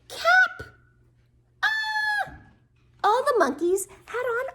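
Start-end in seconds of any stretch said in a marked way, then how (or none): 1.6-2.3 very high-pitched "Ah!"; high cry of amazement; astonished gasp. Acted. Mock astonishment at seeing the monkeys.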